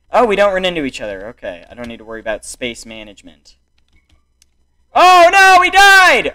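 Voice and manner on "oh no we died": high, loud cry of alarm; dismayed yell. Real, not acted. Player watching the team die, dismayed yell.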